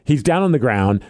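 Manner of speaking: ordinary talk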